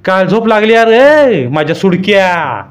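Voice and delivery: high, loud, slow scolding; raging old woman. A narrator's reading. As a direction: lash out at his foolishness. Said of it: "चांगलाच ताव काढला".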